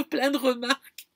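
real laugh breaking into the words; laughing while warning about questions. Real, not acted. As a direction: laugh through the end of the line. Laughs at her own prediction.

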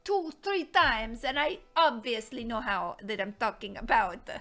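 hammed-up fake Italian accent. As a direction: play a thick, comic Italian accent. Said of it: "I'm doing an Italian accent".

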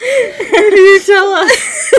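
high, loud, stretched out by laughing; real helpless laughter. Real, not acted. Repeating own joke to partner, crying with laughter. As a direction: lose it laughing mid-phrase.

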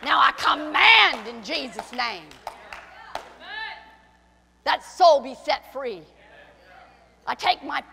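slow, very loud authoritative command; slow shouted declaration of authority. Retold or imitated. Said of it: "we'll start going".

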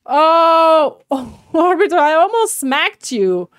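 loud, high, drawn-out startled cry; startled outcry. Real, not acted. Player startled by a teammate they almost smacked, startled outcry. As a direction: cry out as if caught off guard.

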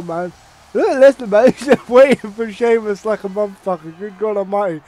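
ordinary talk, nothing marked

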